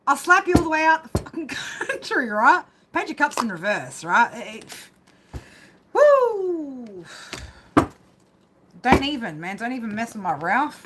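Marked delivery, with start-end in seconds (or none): 0.1-2.3 higher, fast mock threat breaking into laughter; playfully threaten, laughing as you go. Joking threat to someone in reading. 5.9-7.0 loud, high woo sliding downward; excited woo. Real, not acted. Exclaims after the joking outburst.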